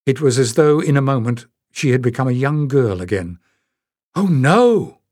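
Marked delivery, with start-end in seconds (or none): 4.1-5.0 high, louder shocked cry; gasped protest. Acted. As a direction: gasp it out in alarm. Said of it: "she gasped".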